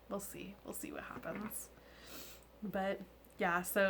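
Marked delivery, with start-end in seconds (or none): 0.1-1.7 hushed whisper. Real, not acted. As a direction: whisper it ominously. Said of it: "whispered aside".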